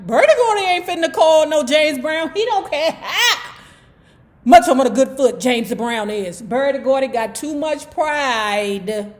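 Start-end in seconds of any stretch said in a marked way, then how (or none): 0.0-6.4 high, loud mock outrage; scoffing disbelief. Host voicing doubters about a phone call, loud high scoffing. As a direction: scoff as if it could never happen.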